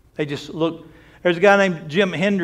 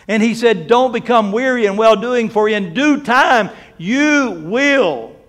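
raised, ringing scripture quote; fervent preaching; 5 semitones higher, 8 dB louder, slower than usual. Acted. Preacher quoting scripture to the church, fervent. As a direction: lift the verse up to the congregation.

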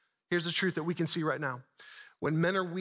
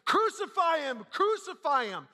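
high, insistent crowd chant-shout; repeated high-pitched cry; 10 semitones higher, slower than usual. Acted. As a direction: shout it like a mob demanding blood. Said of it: "They all start shouting".

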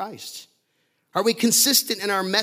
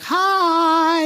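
honk as friendly hi; 12 semitones higher, 8 dB louder than usual. Retold or imitated. New York honk as greeting.

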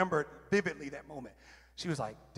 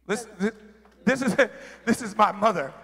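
proud laugh through the words; 5 semitones higher, 9 dB louder than usual. Real, not acted. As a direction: laugh as you say it with pride. Proud laugh about his mother.

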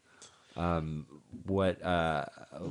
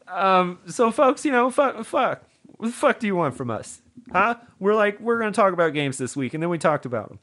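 mock rant; 12 semitones higher, 6 dB louder than usual.

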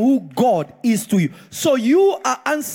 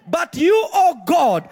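bold prayer; 6 semitones higher than usual. Pretend. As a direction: proclaim God's riches in full voice. Preacher voicing bold prayer, shouting.

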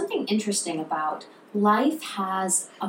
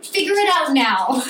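loud, higher-pitched retold order; impatient demand; 4 semitones higher, 5 dB louder than usual. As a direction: demand it forcefully, as if trapped. Retold impatient demand while locked in.

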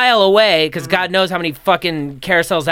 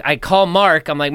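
ordinary talk

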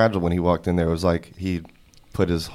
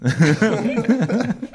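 laughing; 5 dB louder, much slower than usual. Real, not acted. Laughing punchline.

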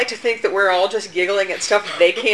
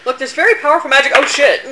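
fast, higher, louder panicked quote; panicked wizard; 6 semitones higher, 9 dB louder than usual. Retold or imitated. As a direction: rush it out like a scared wizard. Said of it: "I was like".

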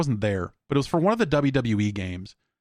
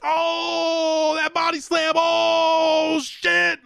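mock shout, much higher and louder; hammed-up influencer reaction; 4 dB louder, much slower than usual. Mocking influencers reacting to a wrestling game with a mock shout. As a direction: overact a streamer freaking out on camera. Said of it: "them going like".

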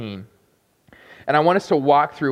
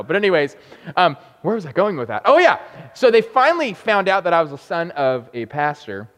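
ordinary delivery

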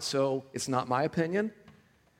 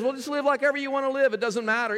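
mock permissive; 9 semitones higher than usual. Mocking lax grace voice.

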